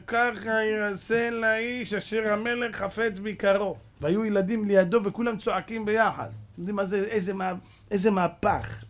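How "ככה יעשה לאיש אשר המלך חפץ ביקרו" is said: slow, drawn-out, higher-pitched call; crier's proclamation. Acted. Haman leading Mordechai through the streets, slow crier's proclamation. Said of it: "וצועק".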